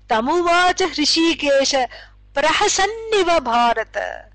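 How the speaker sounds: measured chant of the Gita verse; Sanskrit verse recitation. Shown as a demonstration. Teacher reciting the Bhagavad Gita to class, chanted. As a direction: intone the verse with reverence.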